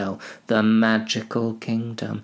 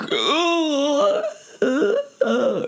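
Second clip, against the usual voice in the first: Nazgul shriek; much slower than usual. Acted. A Nazgul wraith lunging at cushions, high shriek. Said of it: "I can't make the noise that they make".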